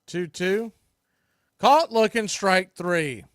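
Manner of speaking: ordinary talk, nothing marked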